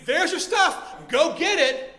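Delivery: loud, high acted outburst; angry. Owner who kicked the thief's bag, angry yell. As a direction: throw it at him at full volume. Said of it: "and I get more angry".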